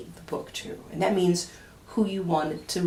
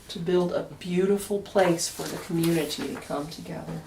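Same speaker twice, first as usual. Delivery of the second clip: ordinary delivery, nothing marked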